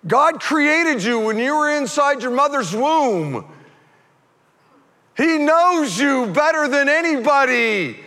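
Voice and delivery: high, drawn-out preaching at full voice; full-force preaching. Real, not acted. Preacher telling the congregation why God came, full-force preaching. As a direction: proclaim it high and slow to the back rows.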